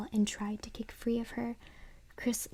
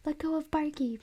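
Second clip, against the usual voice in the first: higher pleading yell, not full force; mock scream; 5 semitones higher, 3 dB louder than usual. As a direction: scream at the dummy to let go. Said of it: "Chris screamed".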